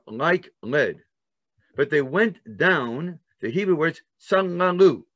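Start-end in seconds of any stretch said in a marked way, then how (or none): 4.2-5.0 "tsalangu." said higher and louder; sound-imitating word. Shown as a demonstration. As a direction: stress the gulping sound in "tsalangu.". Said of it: "what does it sound like".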